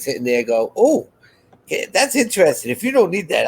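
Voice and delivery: ordinary delivery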